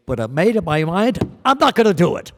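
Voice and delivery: rising to a loud, high declaration; defiant outburst. Retold or imitated. Son to disappointed mother, loud defiant shout. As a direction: announce it loud to his crying mother. Telling mother he won't sing.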